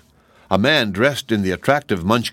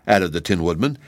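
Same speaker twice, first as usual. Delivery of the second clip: usual speaking voice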